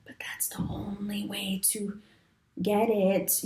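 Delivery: hushed whisper, voice dropped away. Real, not acted. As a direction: lean in and whisper the secret. Secret aside to viewers.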